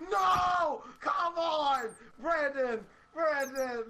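loud, high protesting shout; drawn-out, falling cries of dismay; calling out. Real, not acted. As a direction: holler at your teammate in protest. Calling out to teammate in game.

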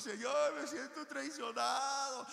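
preacher mimicking himself whining to God; whiny complaint. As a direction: complain to God like a hurt child. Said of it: "de chiplones".

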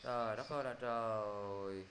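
low, soft, drawn-out exasperation; long quiet lament at a forgetful student; exasperated. Real, not acted. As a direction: let it trail out slowly in weary despair. Student forgot the word, teacher despairs.

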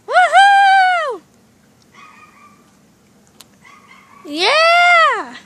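loud, high whooping cheer; excited cheer. Pretend. Pet sailing off on the raft, loud whooping cheer. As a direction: whoop it out as you sail off.

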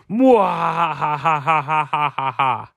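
slow evil villain cackle; drawn-out maniacal mwahaha; mad scientist laugh. Pretend. Villain's evil laugh after taunt.

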